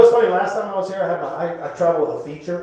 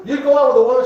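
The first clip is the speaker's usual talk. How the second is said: normal talking voice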